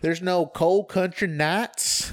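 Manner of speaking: hammed-up, drawn-out country twang; silly country drawl; stupid country accent. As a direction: put on a mock country accent. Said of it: "stupid country accent a lot".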